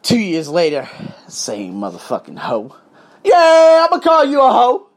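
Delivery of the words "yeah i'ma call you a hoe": a sudden loud, higher jab; loud taunt. Retold or imitated. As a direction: throw the insult out loud. Insulting the racist classmate.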